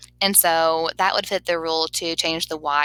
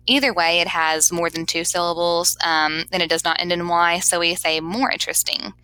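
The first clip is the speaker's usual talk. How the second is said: normal talking voice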